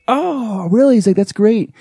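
high-pitched delighted "oh, really"; pleasantly surprised. Retold or imitated. Star reacts to the Comic-Con MC's welcome, pleasantly surprised.

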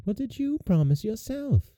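high, soft, mildly reproachful question; sad princess. A narrator's reading. Princess turns the question on her mother, sadly. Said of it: "replied Bellissima sadly".

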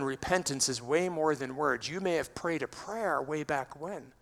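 normal talking voice

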